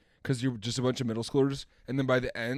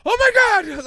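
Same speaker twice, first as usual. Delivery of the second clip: mock scream; 12 dB louder, slower than usual. Imitating show characters screaming.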